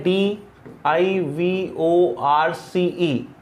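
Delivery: D I V O R C E spelled letter by letter, slow; teacher spelling for the exam; spelled out slowly. Shown as a demonstration. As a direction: spell डीआईवीओआरसीई one letter at a time. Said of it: "इसका pronunciation होता है डिवोर्स".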